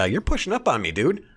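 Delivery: high, exasperated mock protest; retelling the worker pushing back at Biden; imitating the worker. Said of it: "The guy's like".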